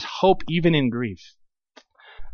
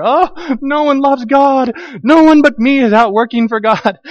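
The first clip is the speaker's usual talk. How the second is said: high, slow whining self-pity; mock self-pity; 8 semitones higher, 5 dB louder, slower than usual. Pastor mocking Elijah's self-pity to the church, high slow whine.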